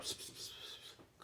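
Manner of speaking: slow unvoiced psst psst to get attention; whispered psst calls. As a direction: hiss psst at a buddy to come over. Calling someone over secretly.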